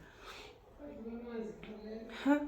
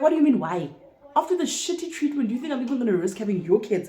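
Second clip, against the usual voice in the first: higher and louder, incredulous; indignant outrage; 5 semitones higher, 4 dB louder than usual. Retold or imitated. Refusing her date after shitty treatment, indignant. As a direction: scoff at the very idea.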